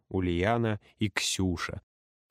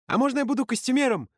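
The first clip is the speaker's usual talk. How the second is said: high, fast squeaky voice; squeaky girl; 13 semitones higher, 3 dB louder, faster than usual. Acted. Ксюша eagerly asking the leader, high squeak. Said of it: "пискнула Ксюша".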